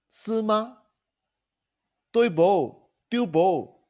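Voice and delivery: high, lifted "是吗" and "对不" in Hokkien; Hokkien question tags. Shown as a demonstration. 老师示范闽南语问句尾. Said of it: "even Hokkien, you can have question tag".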